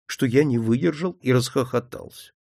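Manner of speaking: ordinary delivery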